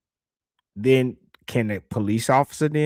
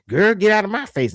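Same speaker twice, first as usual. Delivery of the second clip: put-on a higher, louder sassy teen girl; mock teen girl; 8 semitones higher, 4 dB louder than usual. A niece telling off a girl at school, sassy and higher.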